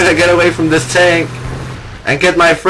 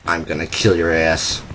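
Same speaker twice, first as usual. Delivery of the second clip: softer, lower menacing growl at an enemy; mock menacing threat; 9 semitones lower, 7 dB quieter than usual. Gamer threatening an enemy walker, mock menace. Threat then taken back.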